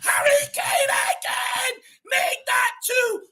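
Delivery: high, loud ecstatic yelling of the name; goal celebration shout. Real, not acted. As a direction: roar it out, the goal has just gone in.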